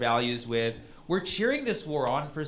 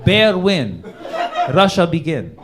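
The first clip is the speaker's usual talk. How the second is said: broken-English narrator, slow and louder with pauses; mock Putin screenplay; 2 semitones higher, 5 dB louder than usual. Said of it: "He's like".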